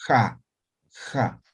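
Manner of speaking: "Ja" like Spanish jota. Shown as a demonstration. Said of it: "Esto es ja". ("ja" igual que la jota)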